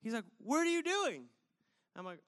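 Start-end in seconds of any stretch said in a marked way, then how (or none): 0.4-1.2 high-pitched incredulous call; imitating a stranger's high holler; retold yell. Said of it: "someone yells".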